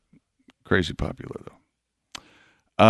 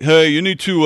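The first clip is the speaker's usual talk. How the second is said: pushy know-it-all caller; know-it-all listener; 7 semitones higher, 8 dB louder than usual. Retold or imitated. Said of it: "this is my know-it-all listener voice".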